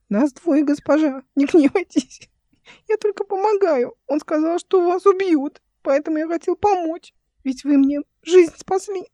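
put-on very high, pleading child's voice; high-pitched, earnest boyish squeak; squeaky boy. A narrator's reading. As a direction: explain yourself as a small, anxious servant boy. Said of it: "писклявый голос мальчика".

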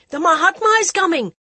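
high, loud crowd cry; excited shout. Acted. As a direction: call it out to the crowd.

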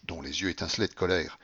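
plain reading voice

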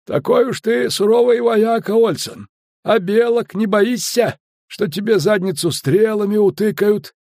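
high-pitched, teasing challenge; needling skipper. A narrator's reading. Skipper doubting the officer's courage, needling.

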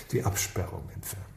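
muttered mocking repeat, soft and breathy; mocking echo. Boss scornfully echoing the junior officer's suggestion, muttered. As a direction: throw his idea back at him under your breath.

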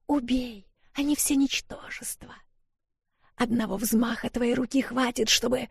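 high, soft ghostly whisper; otherworldly whisper. A narrator's reading. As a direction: whisper like a dark tempting spirit. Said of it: "потусторонним голосом".

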